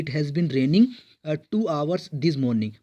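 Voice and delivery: slow reading. Shown as a demonstration. Reads example sentence slowly.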